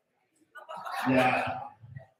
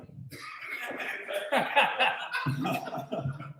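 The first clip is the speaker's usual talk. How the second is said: laughing; 8 semitones higher, 6 dB quieter than usual. Real, not acted. Laughs at own joke.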